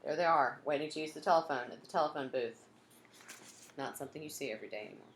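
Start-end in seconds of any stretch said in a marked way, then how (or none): none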